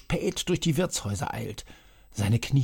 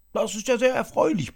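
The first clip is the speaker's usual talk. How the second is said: much higher, louder delighted roar; bellowing; 12 semitones higher, 4 dB louder than usual. A narrator's reading. Herr Puff roars his delight at the news, bellowing. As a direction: bellow your joy across the table. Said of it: "brüllte Herr Puff".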